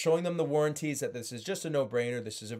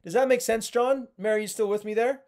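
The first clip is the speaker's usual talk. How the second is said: much higher, louder upbeat check-in; tonal cue checkup; 8 semitones higher, 5 dB louder than usual. Shown as a demonstration. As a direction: brightly check the customers are following. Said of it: "do a little tonal cue, uh, checkup".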